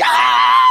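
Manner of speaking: long high falsetto shriek; hammed-up jump-scare scream; startled mock scream. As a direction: shriek as if jumping at a movie. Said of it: "I'm jumping through the movie".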